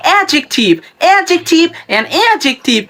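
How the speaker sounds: no d in অ্যাডজেক্টিভ; repeat-after-me pronunciation. Shown as a demonstration. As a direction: drill অ্যাডজেক্টিভ as "A-jek-tiv". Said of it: "আমার সাথে এখন উচ্চারণ করুন".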